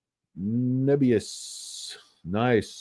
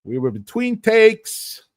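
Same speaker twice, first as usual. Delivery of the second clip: higher, slow, defensive excuse; mock excuse; 10 semitones higher, 5 dB louder, slower than usual. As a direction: plead innocence like a caught actor. Excuse in actor voice.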